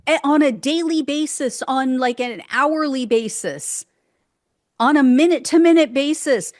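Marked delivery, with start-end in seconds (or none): 4.8-6.5 ranting louder. Real, not acted. Building rant about deportations.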